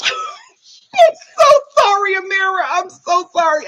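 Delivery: high apology squeezed through laughter; guilty, giggly wail easing off; laughing apology. Real, not acted. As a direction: apologise while cracking up. Apologises while cracking up at impression.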